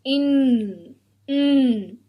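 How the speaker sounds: "In" as a sustained n sound; isolated letter sound. Shown as a demonstration. Teacher models first sound for children, held "In". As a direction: hold the n of "In" as a hum. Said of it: "touch your shoulder".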